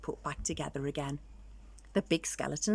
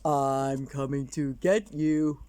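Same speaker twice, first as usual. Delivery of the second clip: slow, low, louder ghostly taunt; spooky threat; 3 semitones lower, 4 dB louder, slower than usual. Acted. Skeleton frightening his friend, slow spooky voice. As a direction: creep it out like a monster.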